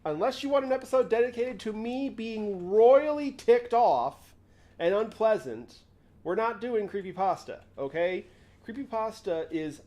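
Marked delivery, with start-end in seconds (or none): 0.0-8.2 louder, higher mock-irritated warning; mock annoyed warning. Real, not acted. Streamer warning chat he'll be ticked off, louder and higher. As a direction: warn them off, half joking, louder.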